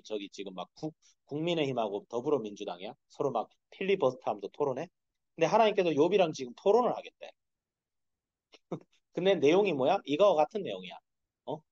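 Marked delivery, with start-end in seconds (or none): none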